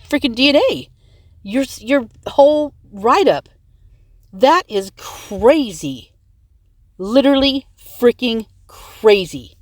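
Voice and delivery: really pissed. Real, not acted. Vlogger venting anger at viewers, heated rant. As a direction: let real anger push the pitch up. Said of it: "very pissed right now".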